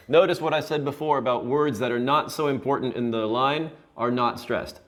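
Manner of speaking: ordinary talk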